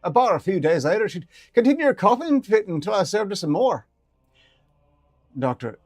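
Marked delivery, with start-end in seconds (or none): 0.0-3.9 high, lively ferret voice with a rough edge. Acted. Ferret explaining owner's cooking, high and lively. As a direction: play the pet ferret, chatty and high. Ferret voice telling about Eve.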